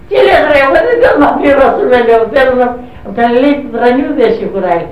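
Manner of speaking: high-pitched, animated objection; retold indignant protest. Retold protest against refusing to pay. (αγανακτισμένη διαμαρτυρία σε αφήγηση)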